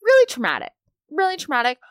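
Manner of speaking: loud, high-pitched overstatement; dramatic exclamation. Real, not acted. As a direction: blurt it out high and loud, dropping at the end. Dramatizes her post office trip.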